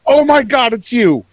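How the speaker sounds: high, loud alarmed goblin; startled cringe. Acted. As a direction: recoil in shock at who it is. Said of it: "He kinda cringes and steps back".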